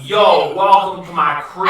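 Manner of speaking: loud homeboy welcome; laid-back dude. Acted. Game master greets players as wizard, loud dude voice. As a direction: greet them like a cool dude, loudly. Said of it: "Just make him sound like this".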